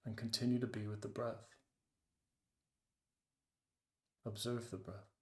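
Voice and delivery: guided meditation. Acted. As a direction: ease them into the breath, unhurried. Leads a meditation.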